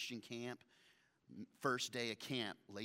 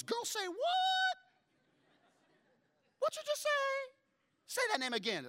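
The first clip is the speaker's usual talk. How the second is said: sassy Naomi voice; 5 dB louder than usual. Retold or imitated. Naomi hears the name Boaz, shrieks in high sassy disbelief. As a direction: play Naomi as a shocked gossiping girlfriend. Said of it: "She goes".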